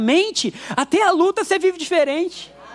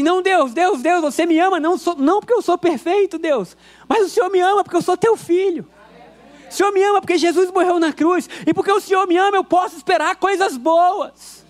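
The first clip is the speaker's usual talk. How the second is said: fervent prayer; 4 semitones higher than usual. Acted. Acted prayer to God.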